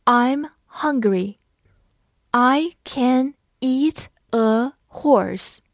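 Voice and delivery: slow, separated words; word by word with a pause after each; slow read-along. Shown as a demonstration. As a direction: model it slowly for learners. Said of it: "那我们慢读一遍".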